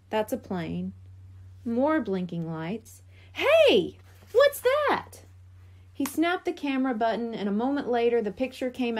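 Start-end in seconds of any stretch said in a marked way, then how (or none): 3.3-5.2 high, loud startled cry; surprised. Acted. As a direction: jump at the sight, much higher and louder. Spots something in telescope.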